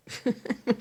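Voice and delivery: quick amused laugh; short laugh. Real, not acted. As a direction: laugh at yourself. Laughs at herself.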